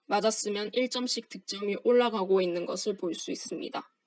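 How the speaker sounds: usual speaking voice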